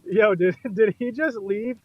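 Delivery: ordinary delivery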